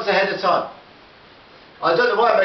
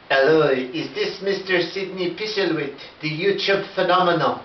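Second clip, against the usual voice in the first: slow, lightly put-on French accent; a French caller taking his time; 3 semitones lower than usual. Yves Saint Laurent caller asks for the YouTube star, in a slow French accent. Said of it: "it's got the accent".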